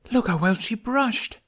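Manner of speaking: high, slow, gushing; showing off a treasure; delighted admiration. A narrator's reading. Tooth admiring a tooth.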